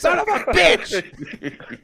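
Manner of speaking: high, very loud, drawn-out cursing; imitated furious shout. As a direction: retell an uncle exploding at the game. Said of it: "he's just like".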